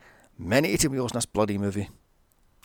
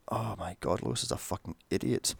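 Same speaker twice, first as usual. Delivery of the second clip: muttered exasperation; 5 semitones lower, 9 dB quieter than usual. Real, not acted.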